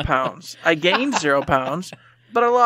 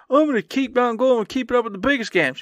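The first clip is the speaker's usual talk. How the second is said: mock eager promise; 7 semitones higher than usual. Mocking past self's promise.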